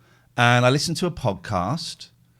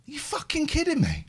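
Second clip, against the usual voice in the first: high, disbelieving swear; mock outrage, pitched up; incredulous outrage; 13 semitones higher than usual. Caller on phone to RAC, high incredulous outrage.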